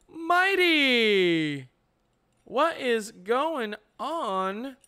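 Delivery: big stretched-out hello to a viewer; excited greeting. Real, not acted. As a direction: call out to a friend who just showed up.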